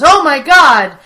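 loud, overacted fake shock; worst acting ever. Friend retelling Jim's terrible fake surprise, hammed-up shout. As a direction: ham up a startled gasp badly. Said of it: "in the worst acting".

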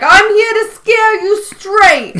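high, drawn-out mock scream with a New York accent; Prison Mike. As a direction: imitate a screaming convict, slow and loud. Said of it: "He, like, starts screaming".

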